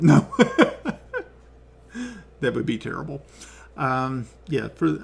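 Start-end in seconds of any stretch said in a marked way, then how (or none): 0.3-1.2 gnome-pitched giggle; high squeaky laugh. Shown as a demonstration. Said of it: "my gnome voice".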